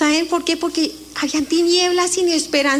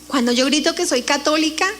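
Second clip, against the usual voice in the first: ordinary delivery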